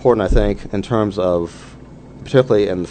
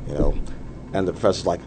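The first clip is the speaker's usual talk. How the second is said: normal talking voice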